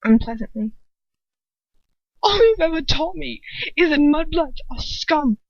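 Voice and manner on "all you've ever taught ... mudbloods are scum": high, loud tearful outburst; bursting out. A narrator's reading. Draco bursting out at his father in anger. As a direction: blurt it out near tears. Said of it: "he bursts out".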